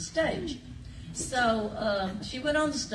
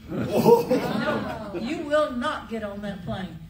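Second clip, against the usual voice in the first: laughing; 2 semitones higher, 5 dB louder, slower than usual. Retold or imitated. Patsy scolding George.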